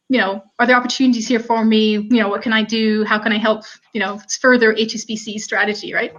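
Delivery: ordinary delivery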